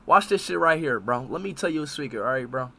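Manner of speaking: low, very soft confiding voice; confiding secret. Real, not acted. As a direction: keep it down, as if sharing a secret.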